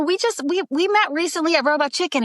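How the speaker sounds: high-pitched, tentative self-quote; eager reminder. Retold or imitated. Actress reminding a star on set that they met, high eager reminder.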